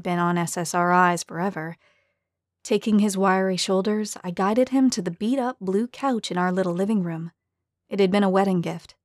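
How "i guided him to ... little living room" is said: plain reading voice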